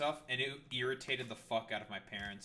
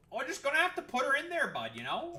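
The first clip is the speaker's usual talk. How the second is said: put-on loud, higher aggressive Canadian accent; 13 semitones higher, 8 dB louder than usual. Streamer hamming up Canadian talk while building, loud Canadian accent. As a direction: bark it like a riled-up Canadian. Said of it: "Canadianisms aside".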